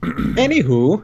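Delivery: slow, high sing-song anywho; drawn-out anywho. Acted. Cohost moving podcast along with slow high anywho. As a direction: stretch it out to change topic. Mock change of subject.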